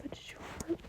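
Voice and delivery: soft playful whisper to the pet; whispered aside. Real, not acted. Teasing her dog mid-story, whispering.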